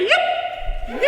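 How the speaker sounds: high rising yip; rallying whoop; cowboy yip. Acted. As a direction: toss up a high yip to rouse a crowd. Calling the crowd for cowboy yips.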